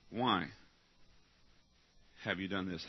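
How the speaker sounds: low, soft, grave question; hushed question. Shown as a demonstration. Angel of God confronting you, low and hushed. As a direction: drop it low and quiet, letting it sink in.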